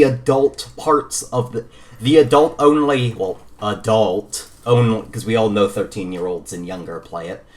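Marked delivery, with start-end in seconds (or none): none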